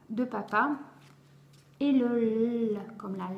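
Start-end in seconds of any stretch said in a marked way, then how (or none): 2.2-2.8 "L" as a long, held consonant; letter-sound demonstration. Shown as a demonstration. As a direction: hold the "L" sound on the tongue. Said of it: "langue qui se lève". (« L » prononcé comme une consonne longuement tenue)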